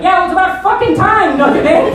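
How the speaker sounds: high, loud sarcastic snap; a mother tired of waiting; sleepy mother-in-law, loud mock exasperation; mother's loud retort. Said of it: "she's like".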